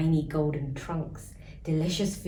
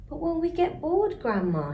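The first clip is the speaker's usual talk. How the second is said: put-on worried child; 10 semitones higher than usual. Child's worried question, high.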